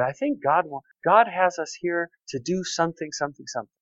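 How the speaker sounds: softly retelling his own words; soft quote. Retold or imitated. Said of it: "We were just talking like this".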